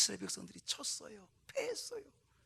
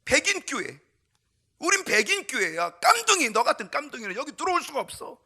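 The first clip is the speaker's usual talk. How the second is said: loud, higher barking usher shutting the door; hostile usher; 7 semitones higher, 11 dB louder than usual. Acted. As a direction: play the usher who throws the boy out. Usher driving the boy away.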